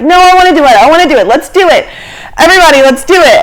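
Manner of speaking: high, loud retold rallying; eager insistence. Girl convincing pilot and group, eagerly insistent. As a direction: insist and rally everyone, pushing each phrase.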